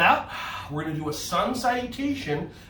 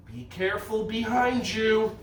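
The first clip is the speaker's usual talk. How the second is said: ordinary delivery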